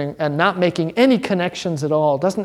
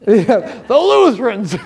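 laughing exclaim; 5 semitones higher, 5 dB louder than usual. Real, not acted. Laughing at the answer.

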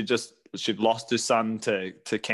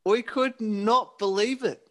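amazed disbelief; 11 semitones higher than usual. Retold or imitated. Recalling amazed upgrade.

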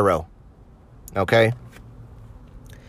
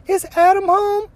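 much higher mock falsetto lady voice; falsetto woman. Prank caller asking for the husband, in high falsetto. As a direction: squeak it out like a fake woman caller. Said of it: "Elmer would ask".

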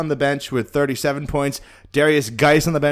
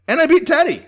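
gloating; 13 semitones higher, 5 dB louder than usual. Real, not acted. Gloating over the win.

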